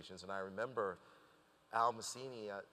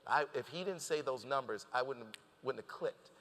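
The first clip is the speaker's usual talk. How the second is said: usual speaking voice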